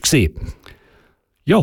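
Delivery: usual speaking voice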